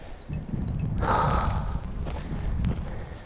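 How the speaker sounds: long breathy exhale of exhaustion; exhausted sigh. Real, not acted. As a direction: blow out a tired sigh. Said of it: "catch my breath".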